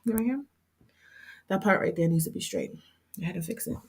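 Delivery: soft, calm and patient, showing the flaw; calm mentor voice. Retold or imitated. Mentor calmly shows the stylist the crooked part. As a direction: gently point it out like a patient teacher. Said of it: "she talked so calm".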